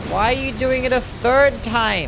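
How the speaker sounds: high, loud, slow disbelieving shout at the boss; drawn-out outraged shout; exasperated yell. Real, not acted. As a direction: yell at the screen in real disbelief. Streamer exasperated at boss attack.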